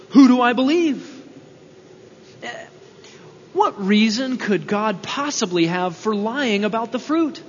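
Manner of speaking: usual speaking voice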